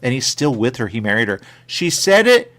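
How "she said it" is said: "it" punched high and loud with a rise; stress on it. Shown as a demonstration. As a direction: lean on "it", pitch climbing. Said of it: "repeats with shifting stress".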